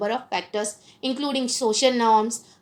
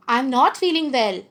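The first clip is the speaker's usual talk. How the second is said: louder, brisk tone that belies feeling unwell; mismatched tone; 4 dB louder than usual. Shown as a demonstration. Lecturer showing students tone against words, mismatched tone. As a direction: say you're unwell without sounding it. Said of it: "my tone of voice is not going with my word".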